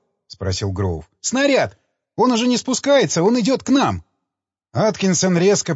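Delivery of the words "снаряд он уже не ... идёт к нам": high, panicked warning cry; panicked shout. A narrator's reading. Engineer warns crew of the approaching machine, panicked high shout.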